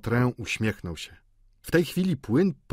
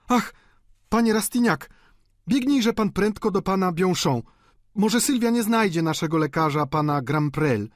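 high, louder, flustered landlady; zaalarmowana wdowa; 10 semitones higher, 4 dB louder than usual. A narrator's reading. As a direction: urge him to run for help. Wdowa posyła po lekarza. (wysoki, naglący głos gospodyni)

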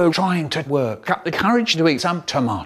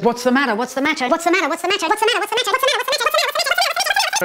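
each ask higher until a squeak; rising questions; much faster than usual. Acted. Kids pestering a crying friend, rising higher and higher. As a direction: climb the pitch with every repeat.

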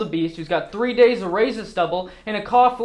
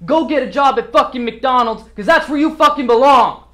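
loud, angry dismissal building to a shout; scornful shout; contemptuous outburst; 3 semitones higher, 8 dB louder than usual. Acted. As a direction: throw the insult at the room, louder as it goes. Acted motivational rant insulting staff.

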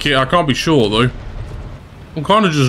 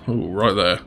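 softer, lower murmur to himself; soft aside; 6 semitones lower, 6 dB quieter than usual. Real, not acted. Gamer spotting a target on thermals, softly. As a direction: say it under your breath. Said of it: "I said".

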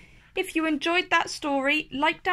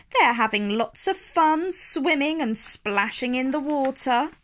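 plain reading voice

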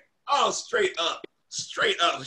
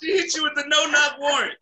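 high, hollering through real laughter; laughing shout; 6 semitones higher than usual. Real, not acted. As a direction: crack up while shouting the tease. Friend howls at the story.

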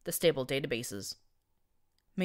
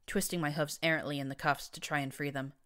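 usual speaking voice

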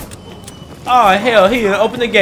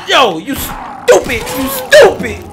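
rising shout, much higher on the last; yelling at a zombie; 11 semitones higher, 4 dB louder, slower than usual. Real, not acted. Gamer screaming insults at a zombie, rising shout. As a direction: scold the zombie, voice climbing.

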